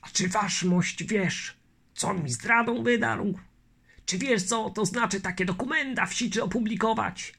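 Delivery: put-on fretting old colonel; old colonel worried. Barabasz fearing Chmielnicki's escape, worried old voice.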